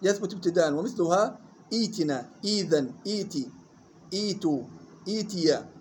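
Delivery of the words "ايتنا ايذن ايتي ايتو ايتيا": ايتنا، ايذن، ايتي، ايتو، ايتيا each begun on long "ee"; kasra then ya. Shown as a demonstration. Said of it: "بهمزة مكسورة ثم ياء". (ايتنا، ايذن، ايتي، ايتو، ايتيا بهمزة مكسورة ثم ياء)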